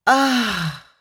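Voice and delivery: relieved moan. Acted. Hannah coming in from the cold, relieved moan. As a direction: let the warmth melt you.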